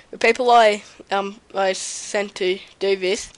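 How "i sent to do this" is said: ordinary talk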